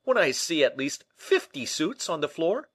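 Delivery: loud, high, incredulous question; astonished cry. Acted. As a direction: protest in disbelief, rising at the end. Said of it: "he cried".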